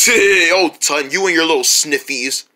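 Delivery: usual speaking voice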